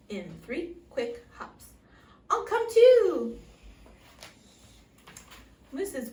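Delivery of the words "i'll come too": high, loud, slow bunny voice; small rabbit calling eagerly; eager little rabbit. A narrator's reading. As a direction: chirp it out like an excited rabbit.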